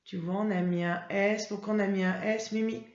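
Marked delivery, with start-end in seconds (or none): none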